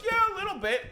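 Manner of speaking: very high-pitched mock boast; mimicking Corey. Friend bluffing to the Chinese driver, high-pitched mimicry. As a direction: squeak it, imitating a bluffing friend. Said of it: "and he, and he goes".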